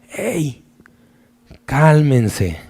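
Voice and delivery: soft, hushed reassurance; calm and loving. Acted. Jesus calms his frightened disciples, soft and tender. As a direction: gently settle frightened friends. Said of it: "con una calma y con un gran amor".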